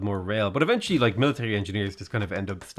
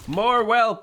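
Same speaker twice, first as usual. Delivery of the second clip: high, louder mock yell; imitated raid scream; 11 semitones higher, 5 dB louder than usual. Gamer quoting a classic raid clip, imitated scream. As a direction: mimic a raid leader yelling orders. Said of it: "screaming at his raid".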